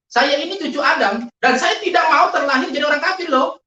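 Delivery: retold protest, high and loud; indignant retort. Convert retelling his answer to an accuser, indignant.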